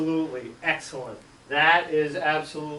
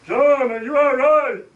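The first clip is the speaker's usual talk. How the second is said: loud higher worried call; 9 semitones higher, 8 dB louder than usual. Retold or imitated. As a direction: call out anxiously while running. Aunt running down to the boy.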